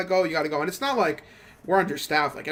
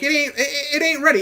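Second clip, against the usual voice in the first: higher and louder insisting; insistent exclaim; 9 semitones higher, 4 dB louder, faster than usual. Retold or imitated. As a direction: hammer it home, sure of it. Insisting it isn't ready.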